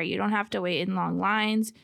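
normal talking voice

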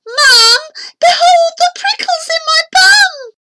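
very high, loud wail to Mum; shrieking, melodramatic yell; anguished shout. A narrator's reading. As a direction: cry out in pain at full pitch. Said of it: "I shouted".

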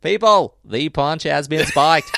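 loud, high, slow announcement; announcing loudly. Retold or imitated. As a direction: call out to the crowd. Quoting Quimby at party.

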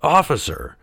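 acted anxious entreaty; pleading. A narrator's reading. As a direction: plead with the policeman. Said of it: "Geppetto pleaded".

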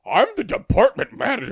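high, loud, puffed-up boss voice; mock him as self-important; pompous manager. Mimicking the department manager, high and pompous.

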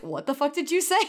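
mock incredulous. Retells players' shocked reaction.

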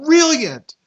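high burst of delight; gushing over the scene; excited praise. Real, not acted. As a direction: rave about it.

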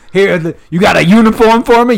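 hammed-up high, loud eagerness; eager recruit. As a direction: pipe up like a keen volunteer.

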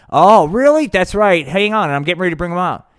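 loud, high puppet-show patriot; high, loud blowhard voice; Hank. Acted. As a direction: belt it out like a fired-up podcast host. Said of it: "That's Hank".

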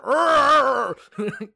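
loud cackling laugh; high wheezing laugh of delight. Real, not acted. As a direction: burst out laughing at your own joke. Big laugh at own joke.

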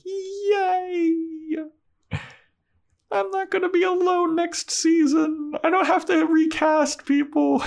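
very high squealing delight; excited squeal. Retold or imitated. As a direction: squeal it out like a thrilled girl. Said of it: "And Buffy's like".

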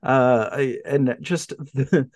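ordinary talk

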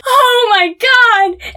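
high, slow, drawn-out gasp of shock; retold shock. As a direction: stretch it out like you just saw disaster. Retold shock seeing washer.

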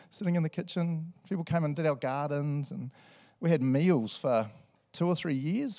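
ordinary talk, nothing marked

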